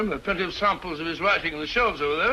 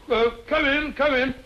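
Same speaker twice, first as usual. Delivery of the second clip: startled, higher and hurried; flustered; 7 semitones higher than usual. Acted. Watson caught snooping, flustered call to the door.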